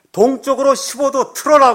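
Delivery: loud, high command through a loudhailer; megaphone order. Retold or imitated. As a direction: bark the heading like a ship's commander. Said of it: "이제 이 확성기를 대고".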